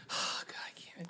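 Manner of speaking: sighing unvoiced whisper; exasperated whisper. Real, not acted. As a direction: whisper in defeat. Gives up after rant.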